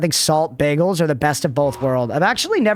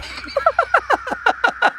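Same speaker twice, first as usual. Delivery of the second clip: high burst of real laughter; big laugh. Real, not acted. Laughing hard at the dinner-table story. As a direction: crack up at own joke.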